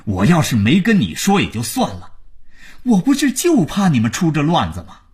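heated phone telling-off; angry scolding. A narrator's reading. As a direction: pile on the reproach. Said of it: "听听万征骂到什么程度了".

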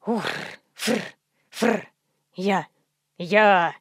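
low snorting grunts; donkey grunts. A narrator's reading.

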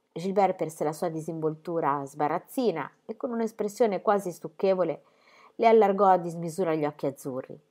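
plain reading voice

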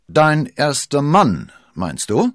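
laut und gedehnt verhöhnen; genüsslich höhnische Nachfrage; höhnisch. A narrator's reading. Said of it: "ergänzte Lord Winter höhnisch". (spöttisch ergänzend)

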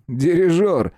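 high, derisive comeback; scornful snort. A narrator's reading. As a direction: snort it back mockingly. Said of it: "фыркнул Эллер".

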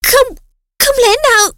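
choked, strangled high gasp; strangled, suppressed exclamation. A narrator's reading. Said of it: "thốt lên bằng một giọng bị nén lại".

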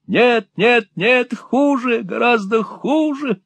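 put-on a robbed old man shrieking in panic; frantic old miser. A narrator's reading.

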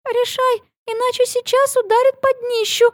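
high, wheezy urgent whisper; hoarse whisper. Acted. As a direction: rasp it out urgently. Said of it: "просипела Лили".

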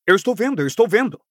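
high-pitched hasty assurance; urgent placating cry; hurried, alarmed. A narrator's reading. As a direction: blurt it twice, voice jumping up. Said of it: "apressou-se ele".

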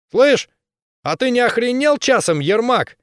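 high, loud thuggish yell; a brawler spoiling for a fight; berserker shouting. Acted. Bully threatens.

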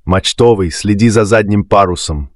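ordinary delivery

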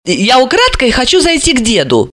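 high-pitched lively character voice; Alisa. Acted.

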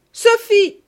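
stepmother's second, fiercer yell; furious scream. Acted. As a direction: scream her name in rage. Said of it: "la voix furieuse de madame Ficquini".

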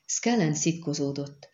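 plain reading voice

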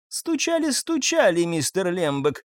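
high, mocking singsong; smirking. A narrator's reading. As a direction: taunt him with a smirk. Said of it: "ухмыльнулась Хелен". (насмешливо, с ухмылкой)

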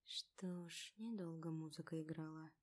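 soft, hollow resigned murmur; muffled whisper. A narrator's reading. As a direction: murmur it quietly in defeat. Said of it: "глухо прошептала Дана".

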